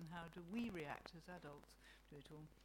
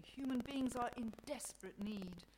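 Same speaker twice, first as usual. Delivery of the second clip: mildly sad, raised voice; 5 semitones higher, 5 dB louder than usual. A narrator's reading. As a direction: lament with a touch of sorrow. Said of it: "God says sadly".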